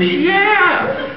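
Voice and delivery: very high, delighted Irish cry; hammed-up high Irish brogue; drunk Irishman. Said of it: "the Murphy twins are drunk again".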